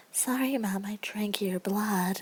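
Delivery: high creepy voice, trailing into slowness. Acted. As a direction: play a creepy mental patient, slowing on the last words. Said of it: "you have to be really creepy".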